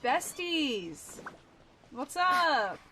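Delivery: loud, high, bubbly hello; excited greeting. Real, not acted. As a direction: greet your friends with a shout. Greeting game characters loudly.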